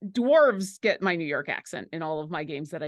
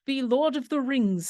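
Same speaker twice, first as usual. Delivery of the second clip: haughty high elf; 5 semitones higher than usual. Retold or imitated. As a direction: look down your nose like a Lord of the Rings elf. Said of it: "sound very haughty".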